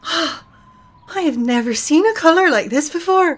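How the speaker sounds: high, breathless wonder at the colour; awed young woman. Acted. Sorsha gasps at the merchant's purple cloth, awed.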